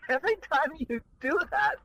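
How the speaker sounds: can't stop giggling; laughing. Real, not acted. Cohost laughing at the host's DJ voice. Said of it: "you make me laugh".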